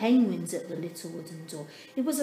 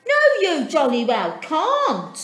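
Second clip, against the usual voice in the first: high, much louder, firm refusal; cross Dudley; 7 semitones higher, 11 dB louder than usual. A narrator's reading. Dudley turns his sisters away, loud and cross. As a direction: slam the no at them. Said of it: "said Dudley".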